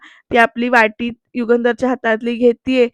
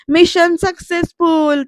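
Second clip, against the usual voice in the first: higher, slow mock yell of triumph; retold loud shout; 5 semitones higher, slower than usual. Mai loudly celebrating the tilak through the earpiece, mock yell. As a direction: call it out slowly like a cheer. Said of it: "जोरात ओरडतात".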